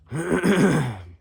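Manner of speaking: loud high throat clear. Real, not acted.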